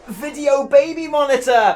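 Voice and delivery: high, loud triumphant announcement; excited reveal. Real, not acted. Answering viewers how they record with a baby, excited reveal. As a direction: present it like the grand answer.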